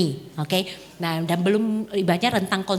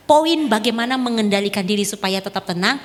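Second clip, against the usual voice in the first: usual speaking voice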